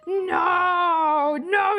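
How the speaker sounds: ordinary delivery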